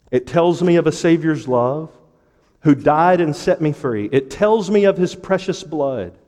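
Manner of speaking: measured, fervent hymn reading; rhythmic pulpit recitation; hymn recited as preaching. Real, not acted. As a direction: declaim the verse, then ease down at its end. Reads hymn aloud to congregation.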